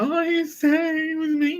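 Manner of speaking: mock the gossiping girls; hammed-up high tattling whine; high gossipy voice. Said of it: "they were like".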